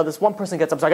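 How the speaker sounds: ordinary talk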